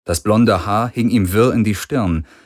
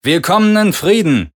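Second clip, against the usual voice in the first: raised, higher and louder, as if through a speaker; a man announcing over a loudspeaker; 11 semitones higher, 6 dB louder than usual. A narrator's reading. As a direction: call it out through the ship's speaker. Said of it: "über Lautsprecher".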